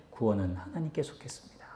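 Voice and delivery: quiet reverent restatement; soft. Real, not acted. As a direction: say it low and quiet like a confession. Key line repeated softly.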